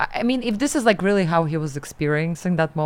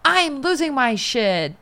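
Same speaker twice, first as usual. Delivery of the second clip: mock losing it; 7 semitones higher, slower than usual. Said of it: "He's doing the thing of".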